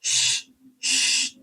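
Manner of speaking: শ- as an unvoiced hiss, like a shush; voiceless শ-, শ- as in hushing someone. Shown as a demonstration. Teacher showing the everyday shushing sound. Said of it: "তো এটা হবে কি".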